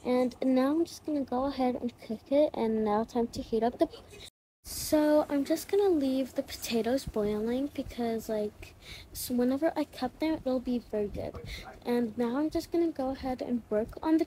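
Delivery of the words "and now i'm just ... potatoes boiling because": usual speaking voice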